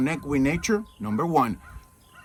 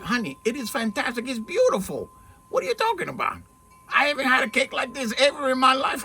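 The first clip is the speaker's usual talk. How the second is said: higher-pitched, gushing praise; retold gushing, pitched up to win her over; enthusiastic reassurance; 8 semitones higher than usual. Husband insisting to his wife the cake is fantastic, enthusiastic reassurance.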